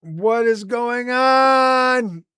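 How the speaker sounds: high, slow, panicked shout and scream; crying out. Acted. Gamer reborn in a strange place, cries out in mock panic. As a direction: cry out in hammed-up fright. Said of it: "I'm gonna cry out".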